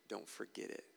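hushed reminder. Acted. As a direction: lean in and murmur it. Repeated line, first take.

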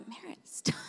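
whispered, half-laughing hush. Retold or imitated. Mother whispering to her son to stop yelling. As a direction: hush him through a laugh. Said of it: "And I'm like".

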